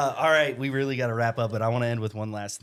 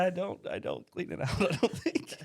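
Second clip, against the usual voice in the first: higher, laughing confession; 8 semitones higher than usual. Real, not acted. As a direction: admit it through laughter. Sheepish admission while laughing.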